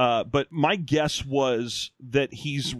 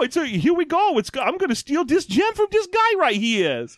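a much higher, put-on New Jersey accent; hammed-up New Jersey tough guy, pitched way up; 12 semitones higher than usual. Quoting the comic's characters in a high, silly New Jersey accent. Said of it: "it's just a stupider New Jersey accent".

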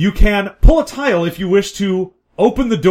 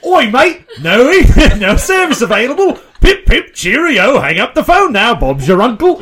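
loud, high mock Cockney English accent; over-the-top English accent, loud and high; hammed-up English accent; 6 semitones higher, 6 dB louder than usual. Shown as a demonstration. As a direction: shout it like a cartoon English bloke. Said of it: "In English accent".

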